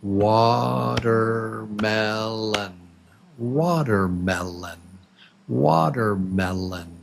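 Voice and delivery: "Watermelon" stretched slowly beat by beat; syllable by syllable. Shown as a demonstration. Teacher counts the beats for students, "Watermelon" syllable by syllable. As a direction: draw out each syllable of "Watermelon". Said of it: "Let's count them together".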